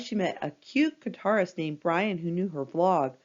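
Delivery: ordinary delivery, nothing marked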